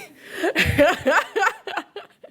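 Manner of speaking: high, loud burst of laughter; real laugh. Real, not acted. Poet apologising over tech trouble, real high laugh.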